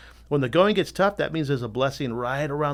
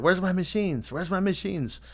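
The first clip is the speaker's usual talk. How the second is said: impatient distributors; 6 semitones higher than usual. Retold or imitated. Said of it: "They're like".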